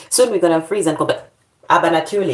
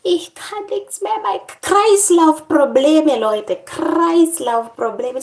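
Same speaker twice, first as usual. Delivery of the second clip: high, slow mock fainting moan; dramatic mock complaint to viewers; hammed-up collapse; 10 semitones higher, 4 dB louder, slower than usual. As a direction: ham up the circulation woes.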